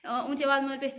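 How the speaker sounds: ordinary talk, nothing marked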